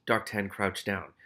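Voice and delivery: usual speaking voice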